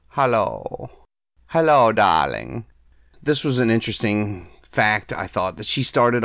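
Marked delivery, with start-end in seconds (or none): 0.1-0.9 put-on Hungarian accent, a falling hello; Hungarian accent, breathy purr. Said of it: "Zsa Zsa and Eva Gabor". 1.5-2.7 put-on Hungarian accent, slow with a rising hello; a slow Hungarian-accented hello, darling; Hungarian accent, purring darling. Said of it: "Zsa Zsa and Eva Gabor".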